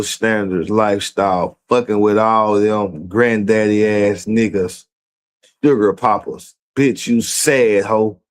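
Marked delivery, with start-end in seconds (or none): none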